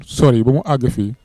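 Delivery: ordinary delivery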